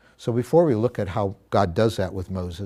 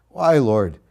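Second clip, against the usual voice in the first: loud cry; 8 dB louder than usual. Retold or imitated. Moses cries out to God.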